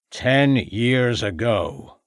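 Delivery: put-on very slow, drawn-out old farmer; slow revelation. A narrator's reading. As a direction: stretch each word out for weight.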